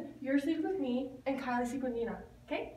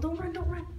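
ordinary delivery

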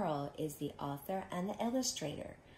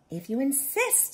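high, lilting, rising reply; light, unbothered answer; yellow jacket; 8 semitones higher, 8 dB louder than usual. Acted. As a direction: accept the challenge coolly, pitched high. Yellow jacket accepts the challenge.

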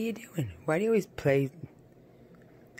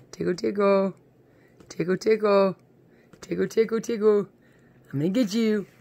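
playful tickling; 4 semitones higher, 5 dB louder than usual. Real, not acted. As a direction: tease the dog as you tickle her.

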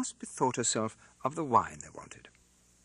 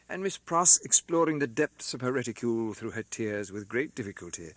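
usual speaking voice

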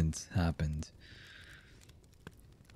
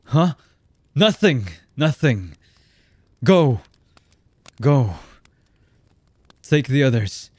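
loud, raised, snapped orders; irritated shouting; 5 semitones higher, 7 dB louder than usual. Acted. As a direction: send them off with impatient shouts. Villain sends underlings away.

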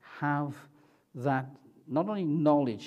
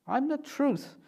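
high emphatic quote; 11 semitones higher than usual. Acted. Repeating Jesus' claim.

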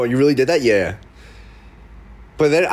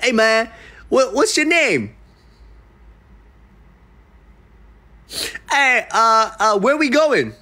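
nervous high questions; 7 semitones higher, slower than usual. Retold or imitated.

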